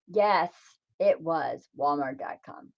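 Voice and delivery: ordinary talk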